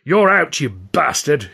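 sudden loud jeering shout; Hurley gloating as he throws the narrator out, shouting. A narrator's reading. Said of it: "he shouted".

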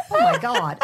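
laughing imitation of a shocked viewer; mock shocked gasp. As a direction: squeal it through laughter like a horrified audience. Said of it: "everyone's gonna be going".

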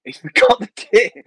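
real laugh through the words, pitched high; high, slowed by laughter. Real, not acted. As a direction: crack up while telling it. Cracking up over his own story.

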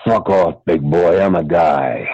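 dropped-low brush-off; low rebuff. Acted. Actor in drag rebuffs, low voice.

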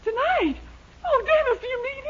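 thrilled squeal. Acted. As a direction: swoon over the invitation. Miss Baker thrilled by date offer.

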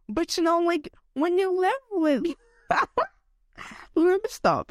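high-pitched, emotional, breaking off with a laugh; getting emotional. Real, not acted. Podcaster confiding about being sick and uncared for, getting emotional. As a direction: get choked up, then laugh it off and stop. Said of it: "I'm getting emotional right now".

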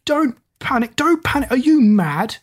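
high-pitched, incredulous panic; panicked retort. A narrator's reading. Doll prince answering the girl who hushed him, panicked retort. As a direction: throw the words back in a high panic.

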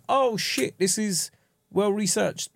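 drawn out like a stunned viewer; impressed surprise. Retold or imitated. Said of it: "and it was like".

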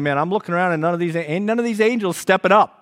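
voicing John's complaint with energy; lively John quote. Preacher voicing John, fast and rising.

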